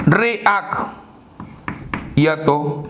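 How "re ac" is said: "Re, ac" split into two separate syllables. Shown as a demonstration. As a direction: sound out "Re, ac" with a break between. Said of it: "Separamos en sílabas". ("Re, ac" separado en sílabas)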